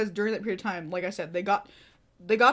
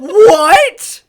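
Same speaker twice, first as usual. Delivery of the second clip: loud, much higher disbelieving what; loud incredulous exclamation; shocked what; 10 semitones higher, 19 dB louder than usual. Retold or imitated. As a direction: blurt out in disbelief. He's drinking on lunch break.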